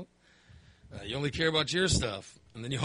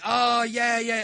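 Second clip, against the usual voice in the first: mild cheer, higher and louder; retold happy scream; 7 semitones higher, 4 dB louder, much slower than usual. Retelling his cheer at the winning play, mildly screaming with joy. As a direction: re-enact a joyful yell, half strength. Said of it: "I was screaming and".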